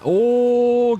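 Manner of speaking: sudden high loud oh as a car darts for a pass; a sharp startled oh from the commentator; surprised oh. Real, not acted. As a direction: caught off guard by the move. Sudden move on track.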